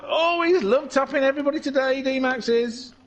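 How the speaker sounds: high, quick racing call; animated high-pitched commentator; excited commentary. Real, not acted. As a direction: call the contact fast and high. Excited call of car contact.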